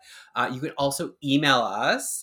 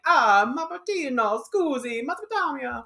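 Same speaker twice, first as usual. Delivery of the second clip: mock Italian neighbours; 12 semitones higher, 5 dB louder than usual. Mocking the Italian community greeting the couple in a hammed-up Italian accent. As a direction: gush like a cartoon Italian neighbour, much higher. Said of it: "They're saying, like".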